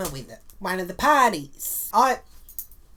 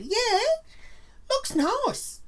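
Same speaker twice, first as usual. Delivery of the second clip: very high, falsely sweet; fake sweet reply; much slower than usual. Brushing off the laughing guests, very high and falsely sweet. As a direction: paper over the hurt with sugary brightness.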